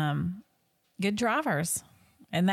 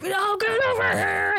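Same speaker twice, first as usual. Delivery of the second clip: panicky parent call; 4 dB louder than usual. Retold or imitated. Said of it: "you're like".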